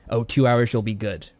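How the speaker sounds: ordinary talk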